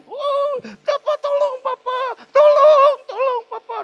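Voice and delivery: very high, loud panicked pleading; hammed-up desperate shrieks; acted child's cry for help. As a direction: scream for Papa like a terrified child. Acts child tied up, crying for help.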